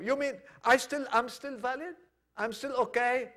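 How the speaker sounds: high-pitched disbelieving questions; amazed questioning. Retold or imitated. Peter asking if he is still accepted, amazed questioning. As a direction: ask it up high, hardly believing.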